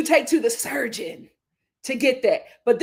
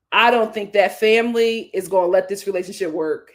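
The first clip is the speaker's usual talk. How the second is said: ordinary delivery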